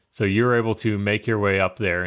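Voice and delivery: normal talking voice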